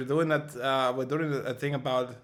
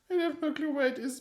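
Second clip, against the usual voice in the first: laughing; 12 semitones higher than usual. Pretend. High laughing put-on voice.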